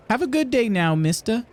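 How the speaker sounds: high newsboy farewell; paper boy. Acted. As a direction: send them off as a little paper boy. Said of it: "Talk to the paper boy".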